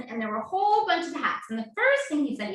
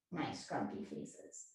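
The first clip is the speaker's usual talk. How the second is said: soft whisper; whispered praise; 11 semitones lower, 15 dB quieter, slower than usual. Real, not acted. Teacher whispering praise to kids making grumpy faces. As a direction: breathe the praise in a hush.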